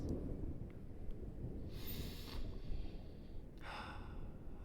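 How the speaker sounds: a slow, breathy, weary exhale; drawn-out hushed sigh; long whispered sigh. Acted. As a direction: let out a long, soft sigh before the ultimatum. Villain pauses before final offer.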